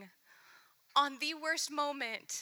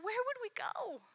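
higher, eager outburst as Peter; bursting out as Peter; 8 semitones higher, 4 dB quieter than usual. Acted. Peter answering Jesus, an eager high outburst. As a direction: blurt it out like Peter. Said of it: "Peter's the one that bursts out".

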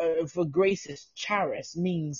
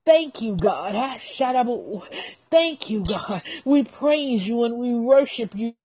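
raised, high cries of thanks to God; fervent praise; 4 semitones higher, 3 dB louder than usual. Real, not acted. Worshipper thanking God aloud, fervently. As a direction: cry it out in worship, pitch leaping up.

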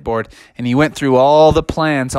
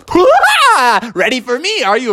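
high, sudden taunting cry; high cartoon challenger voice; hammed-up rival kangaroo; 9 semitones higher than usual. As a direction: pop up with a startling challenge. Kevin pops up behind Froggy.

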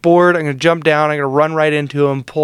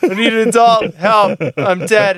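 higher, insistent calls for attention; mock helpless pleading, repeated insistently; annoying pestering; 5 semitones higher than usual. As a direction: imitate a needy gamer nagging teammates. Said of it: "I was being annoying".

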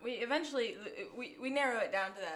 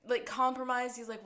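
ordinary delivery

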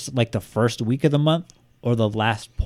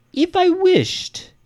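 much higher, very slow, hammy; mock villain voice; 4 dB louder, much slower than usual. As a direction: stretch it like a gloating spirit. Put-on voice inside the letter.

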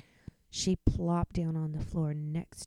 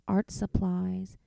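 usual speaking voice

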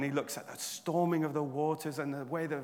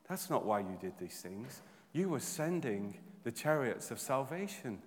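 low, soft, measured reply; hushed answer; 5 semitones lower, 8 dB quieter than usual. Acted. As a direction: drop low and quiet for the answer. Answering his own question in sermon.

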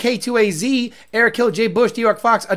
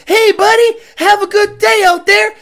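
fake friendly; 11 semitones higher, 5 dB louder than usual. Mimicking fake friendly racers.

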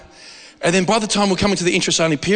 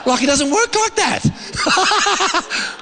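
high-pitched laughter breaking through the words, slow; laughing; 13 semitones higher, much slower than usual. Real, not acted. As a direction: laugh so hard the words squeak out. Laughing at himself mid-sermon.

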